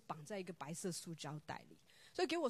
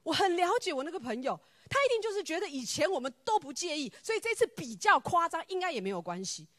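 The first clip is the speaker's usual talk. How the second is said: higher, louder, sure of herself; retold brushing off; 7 semitones higher, 10 dB louder than usual. Preacher retelling how she dismissed the Spirit, insistent and loud. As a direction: wave the warning away, certain. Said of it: "我说：".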